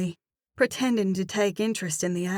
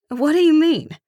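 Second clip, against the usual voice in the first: much higher, louder puzzled cry; startled; 10 semitones higher, 9 dB louder than usual. Acted. Narrator baffled by the cashier's remark, startled. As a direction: caught off guard.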